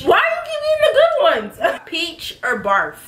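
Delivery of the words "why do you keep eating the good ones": indignant. Real, not acted. Sibling keeps getting good flavors.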